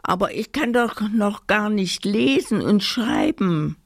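high, whiny doll's protest; plaintive complaint. A narrator's reading. Doll Amanda protests she can't read yet, plaintive. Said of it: "klagte die Puppe Amanda".

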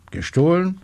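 high, astonished echo question; startled question. A narrator's reading. Inspector startled by the theft. (erstaunte, hohe Rückfrage)

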